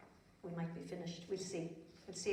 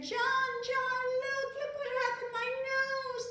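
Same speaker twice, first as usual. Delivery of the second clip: put-on high, loud little girl calling; a worried child calling her friend, pitched way up; upset Prunella; 6 dB louder, slower than usual. A narrator's reading. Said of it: "so she said".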